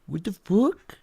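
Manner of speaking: rising what the fuck in disbelief; can't believe what you just heard; stunned disbelief. Retold or imitated. Retold shock at accusation.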